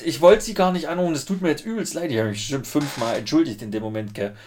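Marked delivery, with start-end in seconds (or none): 0.0-2.1 flustered apology. Retold or imitated. Own apology retold.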